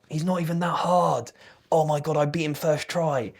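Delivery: a hint of a smug online troll; mocking troll commenter. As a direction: sneer it like a know-it-all commenter. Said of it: "people love to be like".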